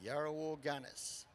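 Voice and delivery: ordinary delivery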